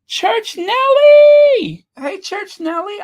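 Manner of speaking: high, loud, drawn-out greeting shout; excited call-out. Real, not acted. Streamer spotting a regular viewer in chat, excited high shout. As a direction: hail a friend across the room, stretching the name.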